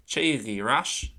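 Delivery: ché gí rash drawn out slowly and clearly; slow Irish model phrase. Shown as a demonstration. Teacher to class, slow clear Irish example. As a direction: spell out ché gí rash for learners. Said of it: "go back".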